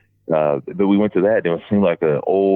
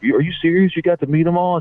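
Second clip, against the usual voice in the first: higher, incredulous questioning; retold amazement; 10 semitones higher than usual. Elevator strangers asking about meeting the wrestlers, imitated amazement.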